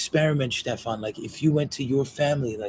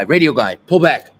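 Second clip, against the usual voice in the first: ordinary delivery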